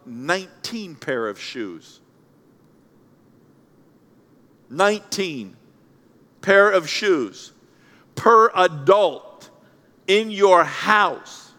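slow, word by word; deliberately slow stat for effect; slow emphasis. Real, not acted. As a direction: let each word land slowly. Stat spelled out slowly.

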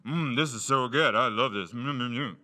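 loud, pleased mm over a donut; savoring delicious food. Retold or imitated. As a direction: moan with delight at each bite. Eating donut alone, savoring it.

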